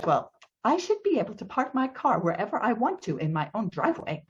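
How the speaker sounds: high, quick sulky protest; petulant teenager. Retold or imitated. Neighbor defending his own driveway, mimicked as petulant teenager. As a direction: imitate a whining, entitled teen. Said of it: "like a petulant teenager".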